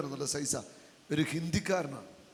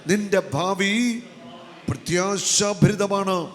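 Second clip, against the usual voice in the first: preaching at full force; 5 dB louder than usual. Acted. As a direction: proclaim every phrase with a pause, building. Preaching verse with congregation. (ഉച്ചത്തിൽ പതുക്കെ വചനം പ്രഘോഷിക്കൽ)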